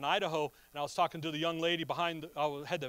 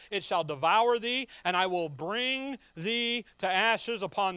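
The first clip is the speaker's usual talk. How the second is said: measured, solemn scripture read; 4 dB louder than usual. Acted. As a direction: read the verses like pronouncing judgment. Reading scripture aloud.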